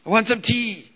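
put-on little boy insisting on tea; toddler asking again. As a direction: push the demand harder like a stubborn toddler. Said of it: "And again, it's".

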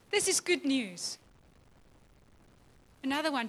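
normal talking voice

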